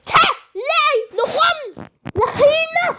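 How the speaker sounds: very high, loud made-up voice; high squeaky gibberish. Acted. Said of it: "he said".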